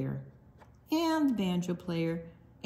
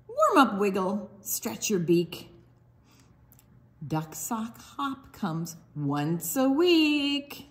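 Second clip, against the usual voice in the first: sing-song picture book read; reading to children; 3 semitones higher, slower than usual. Acted. Librarian reads duck book to children, sing-song. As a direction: bounce the rhymes for little listeners.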